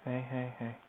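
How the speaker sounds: soft, gentle hushing; soothing. Real, not acted. Soothing the baby.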